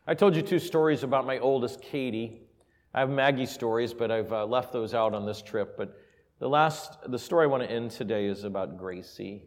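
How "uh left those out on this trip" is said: ordinary talk, nothing marked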